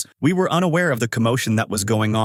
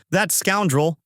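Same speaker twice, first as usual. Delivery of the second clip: higher, mildly angry exclamation; angry snarl; 7 semitones higher, slower than usual. A narrator's reading. Manager cursing the inspector, mild angry snarl. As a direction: spit the insult with narrated anger. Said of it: "Park gets angry as he snarls".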